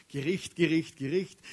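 a hint of a bearded prophet crying judgment; mock prophet shouting. Youth preacher imitating Old Testament prophets, mildly mock shouting. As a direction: call out Gericht like an old doomsday prophet. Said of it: "lange Bärte gehabt und rumgeschrien".

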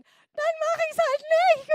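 very high, drawn-out defiant yell; angry outburst. Retold or imitated. Singer storming out of the studio with her suitcase, yelled defiance. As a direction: scream the refusal, stretched out and piercingly high.

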